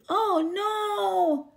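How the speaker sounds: ordinary delivery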